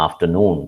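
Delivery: "afternoon" stressed on NOON; after-NOON, drawn out at the end; stress on third syllable. Shown as a demonstration. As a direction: lean on the last syllable of "afternoon". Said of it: "third syllable stressed".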